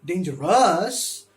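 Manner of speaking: dange-ROUS, weight thrown on the final ROUS; wrongly stressed last syllable. Shown as a demonstration. Teacher showing a wrong accent, last syllable stressed. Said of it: "It's not dangerous".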